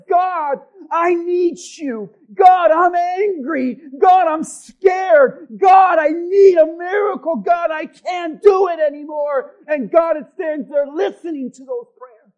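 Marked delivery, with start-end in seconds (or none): 0.1-9.5 higher, loud, slow cries to God; bold honest prayer. Shown as a demonstration. Pastor showing church how to pray boldly. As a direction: cry out desperately for a miracle. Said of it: "boldness and an honesty that says".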